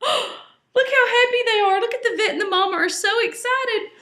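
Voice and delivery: high, fast, gasping delight; delighted excitement. A narrator's reading. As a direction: share the joy breathlessly. Delighted narrator.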